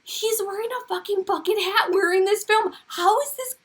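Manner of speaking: high-pitched, amazed appeal; astonished, high exclamation to everyone; real incredulity. Real, not acted. Amazed her dream matches the video.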